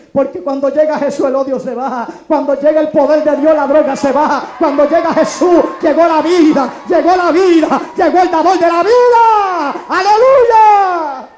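loud, rhythmic revival shout; roaring preacher's crescendo; full-force preaching. Real, not acted.

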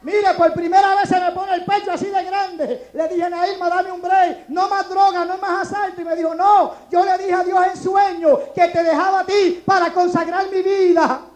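loud preached storytelling; fiery testimony. Real, not acted. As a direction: preach the story with full force.

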